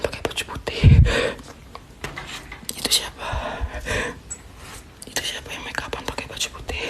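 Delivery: very soft, voiceless whisper; frightened whisper. Real, not acted. Asking who sits at the dressing table, frightened whisper. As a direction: whisper so the figure won't hear.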